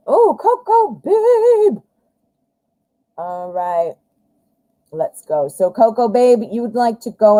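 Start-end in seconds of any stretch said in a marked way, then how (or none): 0.1-1.8 high, slow, sing-song hello; delighted greeting. Real, not acted. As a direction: greet a friend you are thrilled to see. Greets a viewer joining the stream.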